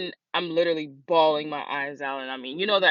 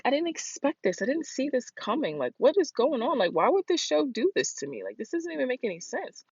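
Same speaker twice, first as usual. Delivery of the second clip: high-pitched retold disbelief; bewildered protest; 9 semitones higher than usual. Retells baffled protest at the show.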